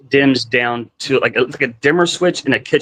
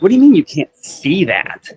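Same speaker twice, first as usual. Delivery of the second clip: higher-pitched incredulous comeback; retold disbelief; 5 semitones higher than usual. Husband to wife who can't see it, incredulous. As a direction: re-enact challenging the wife in disbelief.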